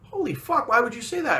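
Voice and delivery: imitating a stunned friend, pitch leaping up; shocked friend. Friend reacting to the airport insult, high shocked imitation. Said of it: "Aaron's face going".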